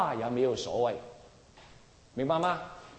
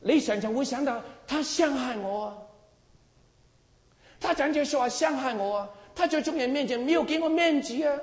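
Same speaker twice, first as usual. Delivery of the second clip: higher, whining voice of a wronged believer; aggrieved complaint; 10 semitones higher than usual. Retold or imitated. Believer complaining he lost face, mock aggrieved whine. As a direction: mimic the one who feels slighted.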